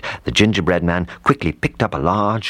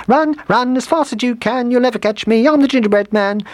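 very high little gingerbread man; gleeful taunting call; 4 dB louder than usual. A narrator's reading. Gingerbread man escaping the cows, gleeful high call. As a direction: sing out the taunt in a tiny squeaky voice. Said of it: "he called as he disappeared".

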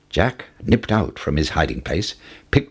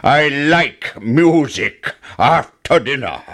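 slow, higher-pitched giant bellow; roaring giant; 6 semitones higher, 4 dB louder, slower than usual. A narrator's reading. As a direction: boom it out slowly as the giant. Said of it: "roared the giant".

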